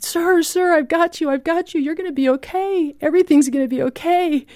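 high, urgent calling out to a man in the water; urgent shout. Retold or imitated. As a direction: shout reassurance over the wind, pitched high. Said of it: "I just shouted".

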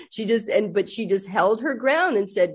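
ordinary talk

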